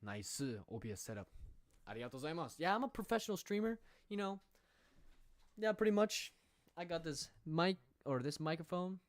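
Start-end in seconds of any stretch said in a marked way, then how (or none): 2.6-6.3 higher and louder, playful bragging; cheeky fake swagger about the gear; mock-proud boast. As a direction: ham up the pro streamer pose. Mock-bragging about being a pro.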